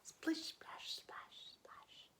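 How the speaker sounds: fading whispered splashes. Acted. Narrator whispering the splashing into the pond. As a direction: whisper the splashes away to nothing. Sound effect of landing.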